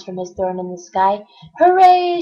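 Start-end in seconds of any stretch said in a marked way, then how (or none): 1.6-2.2 loud high cheer; shouted. Acted. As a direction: whoop in triumph. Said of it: "shouted Yertle".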